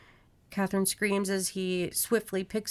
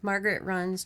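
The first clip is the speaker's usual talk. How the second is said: plain reading voice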